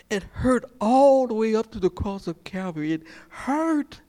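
high-pitched preaching build; preacher retelling Peter's denial, high and fervent; rising sermon fervor. Real, not acted. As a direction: lift the voice as the story peaks. Preaching at full force.

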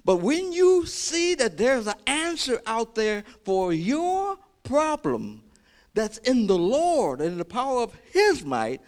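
loud, high, impassioned sermon delivery; rousing pulpit shout with stretched words; full-force preaching. Real, not acted. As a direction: preach it at the top of your voice.

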